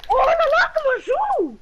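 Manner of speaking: high, strained frustrated yelling; losing it at the game; raging gamer. Real, not acted. Gamer losing a fight, rising frustration.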